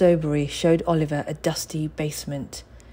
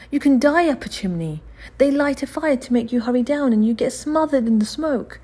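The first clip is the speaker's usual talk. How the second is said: put-on frightened boy; 8 semitones higher, 4 dB louder than usual.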